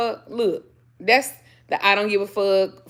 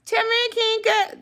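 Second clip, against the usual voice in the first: high coaxing; slower than usual. Real, not acted. Coaxing the dog.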